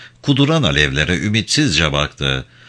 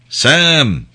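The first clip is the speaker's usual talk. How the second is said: a high, louder call across the distance; 8 semitones higher, 3 dB louder than usual. Acted. Voice from below calling up to Crow, loud call. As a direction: call his name from far below.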